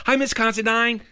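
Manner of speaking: put-on high kid's hello called into a room; cheery called greeting. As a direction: call out a greeting like a schoolboy, high. Child calls greeting into classroom.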